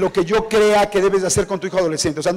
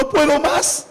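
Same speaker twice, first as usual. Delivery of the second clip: imitating a crying mother, pitch lifted high; tearful plea; 7 semitones higher, slower than usual. Mother telling the author she can't cope, mock tearful. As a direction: voice a mother breaking down, lightly. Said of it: "viendo a la mamá llorando".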